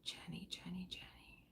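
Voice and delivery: breathy whisper calling a name softly. Real, not acted. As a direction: call her name under your breath, barely audible. Calling someone quietly by name.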